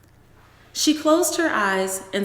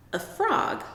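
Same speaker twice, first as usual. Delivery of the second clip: sharply higher incredulous question; surprised question; 12 semitones higher, 3 dB quieter than usual. Acted. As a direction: doubt him with a jump in pitch. Mother surprised.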